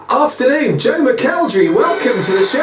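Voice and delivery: high, rousing show-opener greeting; big radio welcome. Real, not acted. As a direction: welcome your star guest with a high-pitched flourish. Host greets guest on air.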